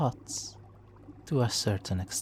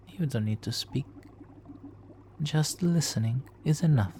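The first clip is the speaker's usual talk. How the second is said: usual speaking voice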